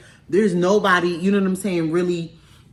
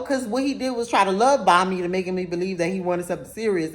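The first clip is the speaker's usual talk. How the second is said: ordinary talk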